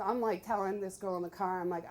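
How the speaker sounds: ordinary delivery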